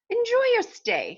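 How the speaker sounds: a light put-on British accent for the host; lightly British, welcoming the guests. Teacher reading the host to her class in a light British accent. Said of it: "I hope you enjoyed my accent".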